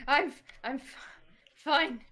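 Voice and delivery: high, broken-off stammer; hurt and faltering. Acted. Hurt Ari answers Gris, faltering and strained. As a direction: struggle to get the reassurance out. Hurt character insists on being fine.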